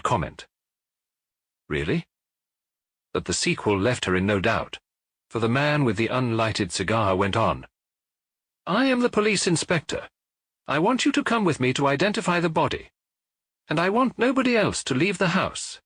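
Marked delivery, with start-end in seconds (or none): 1.7-2.1 a lightly voiced, tight-lipped "Really?"; tight-lipped doubt. A narrator's reading. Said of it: "tight-lipped". 8.7-15.8 higher, officious police inspector giving orders; brisk inspector. A narrator's reading.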